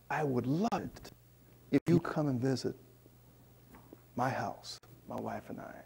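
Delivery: lightly voiced quiet host inviting the couple home; soft quoted invitation. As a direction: voice the host's gentle offer softly. Host's gentle invitation retold.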